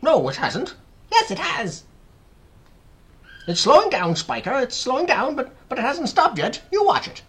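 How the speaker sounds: high, loud bickering; excited squabbling. A narrator's reading. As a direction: argue back shrilly. Aunts squabble over the peach.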